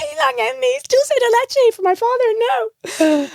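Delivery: very high, squealed comic refusal; hammed-up indignant squeak; mock outrage. Refusing her father the dulce de leche, mock outrage. Acting out refusal to share chocolates.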